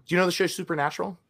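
ordinary talk

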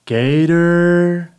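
stretched-out announcer intro; hype announcer. Acted. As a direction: drag each word out like a ring announcer.

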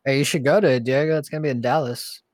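usual speaking voice

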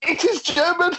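high-pitched, cracking into laughter; gleeful reveal. Real, not acted. Revealing where Kicker magazine is written, laughing gleefully. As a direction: deliver the punchline, barely holding the laugh.

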